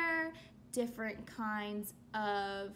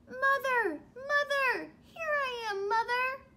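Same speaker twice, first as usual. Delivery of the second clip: high, loud cries for mother; pleading baby bird; 11 semitones higher, 5 dB louder than usual. Acted. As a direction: run up calling desperately. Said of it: "he said to the thing".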